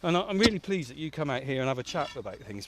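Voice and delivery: normal talking voice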